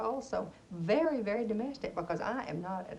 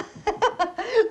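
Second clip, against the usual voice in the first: high, loud laugh at her own joke; 10 semitones higher, 9 dB louder than usual. Acted. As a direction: burst out laughing.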